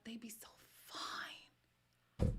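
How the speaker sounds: slow breathy whisper aside. Real, not acted. Gushing to viewers about Texan men, a slow whisper. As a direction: whisper it slowly like a secret.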